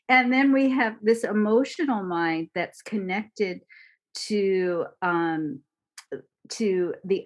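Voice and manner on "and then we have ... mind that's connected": ordinary talk, nothing marked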